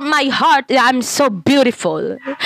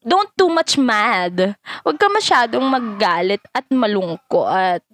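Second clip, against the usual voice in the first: ordinary talk, nothing marked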